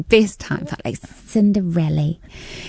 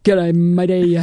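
hammed-up New Zealand accent; slower than usual. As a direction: play up the New Zealand twang. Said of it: "Really super".

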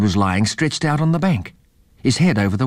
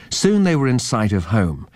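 ordinary delivery, nothing marked

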